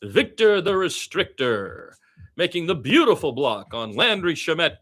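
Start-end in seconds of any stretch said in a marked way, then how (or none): none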